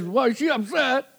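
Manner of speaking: high-pitched mock upset; upset husband. Husband who doesn't know why wife's upset, mock exasperation. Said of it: "then you get upset".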